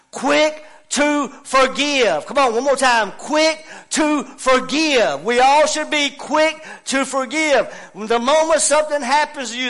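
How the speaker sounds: loud, high revival preacher; full-force preaching. Real, not acted. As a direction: drive it home to the congregation.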